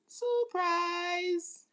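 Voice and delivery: high sing-song surprise; taunting party-reveal surprise; gleeful exclaim. Real, not acted. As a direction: tease them with a bright reveal. Repeated taunt to the haters.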